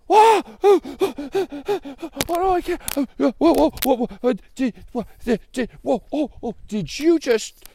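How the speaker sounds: loud, high stammering shock; flabbergasted. Acted. Joey hears a bird speak, stammering in shock. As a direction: splutter whoas, unable to finish.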